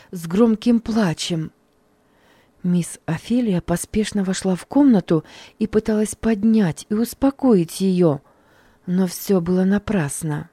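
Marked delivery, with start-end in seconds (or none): none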